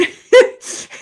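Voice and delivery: high burst of laughter; real burst of laughter; loud laugh. Real, not acted. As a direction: crack up out loud. Laughing at own joke.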